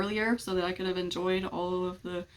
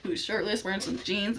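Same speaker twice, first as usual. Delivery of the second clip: ordinary talk, nothing marked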